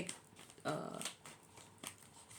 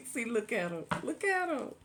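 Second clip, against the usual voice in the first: giggly excited; 6 semitones higher than usual. Real, not acted. Laughing at cards showing up.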